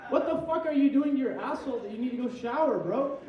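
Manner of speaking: yelling what the hell in disbelief; loud outraged disbelief. Real, not acted. Comic yelling at a friend's bathroom habits, loud disbelief. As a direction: shout it, baffled and disgusted.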